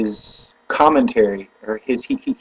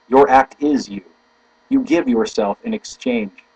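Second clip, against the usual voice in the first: measured declamation with punched key words; solemn quote reading; 3 semitones higher than usual. Acted. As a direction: read it phrase by phrase, with weight. Reads a quotation aloud.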